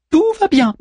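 high-pitched small figure voice; Manmut's voice. A narrator's reading. Manmut reassures his companion, high character voice. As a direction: pitch it far up, lightly reassuring.

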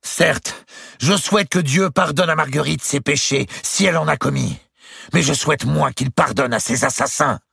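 the duke flings blame at his rival; Duke of Burgundy's bitter retort. A narrator's reading. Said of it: "Le duc de Bourgogne lançait".

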